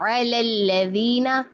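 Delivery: slow, measured tajweed with drawn-out vowels; Quran recitation. Shown as a demonstration. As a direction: recite verse fragments slowly, holding each L light. Recites verses as models.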